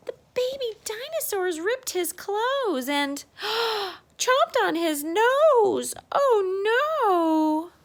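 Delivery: hammed-up pretend sob; mock crying. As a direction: ham up the dismay like a fake cry. Mock distress at baby biting.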